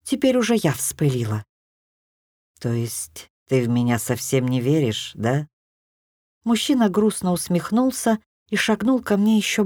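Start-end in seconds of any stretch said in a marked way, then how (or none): 2.6-5.5 low male voice. A narrator's reading. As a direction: lower dark lord voice, wounded. Тёмный властелин говорит. (низким голосом, с лёгкой обидой)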